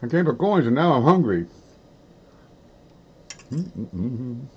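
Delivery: talking through a mouthful; chewing while speaking; mouth full. Real, not acted. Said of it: "Don't talk with your mouth full".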